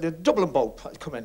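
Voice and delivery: ordinary talk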